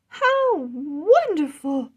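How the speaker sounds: high, slow, loud cry of delight. Acted. Queen greeting the returning dragons, high delighted cry. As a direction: gush with joy as the queen. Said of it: "Queen Rose cried".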